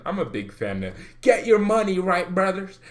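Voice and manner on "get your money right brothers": loud, high preacher voice. Pretend. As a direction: preach it to the crowd. Quoting a money motto like preacher.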